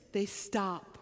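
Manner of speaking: ordinary talk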